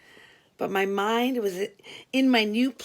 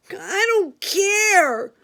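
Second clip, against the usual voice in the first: much higher, louder, slow bleat; bleating Frankie; 11 semitones higher, 7 dB louder, much slower than usual. Retold or imitated. Inmate Frankie bleating that he doesn't care about the other men. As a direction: bleat it out stubbornly. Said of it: "Frankie bleated".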